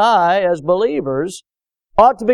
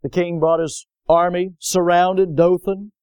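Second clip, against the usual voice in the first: ordinary talk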